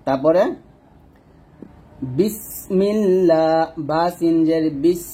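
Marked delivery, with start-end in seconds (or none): 2.2-3.6 slow, drawn-out Bismillah; recited basmala. Shown as a demonstration. Teacher starts the basmala for viewers. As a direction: intone it. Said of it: "recites basmala".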